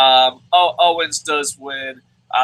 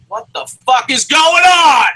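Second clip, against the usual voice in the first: exasperated shout; 9 semitones higher, 8 dB louder than usual. Real, not acted. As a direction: yell it high and loud in disbelief. Exasperated outburst at wrestling booking.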